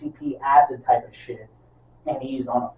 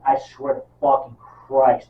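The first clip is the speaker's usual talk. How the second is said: ordinary talk, nothing marked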